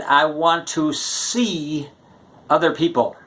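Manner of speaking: slow, spelled-out repeat. Retold or imitated. Girlfriend repeating her breakup line slowly. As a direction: say it again very slowly, word by word. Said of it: "She said".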